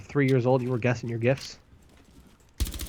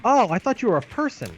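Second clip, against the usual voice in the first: high, loud mock surprise; 11 semitones higher, 6 dB louder than usual. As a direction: play it big and surprised. Teasing the drone.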